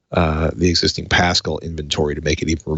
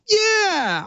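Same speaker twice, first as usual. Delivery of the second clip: much higher goofy shopper voice; mock shopper. Host mimics a store shopper giving in, much higher mock voice. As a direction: play a gullible customer caving in. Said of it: "lay on a filthy mattress for five minutes and go".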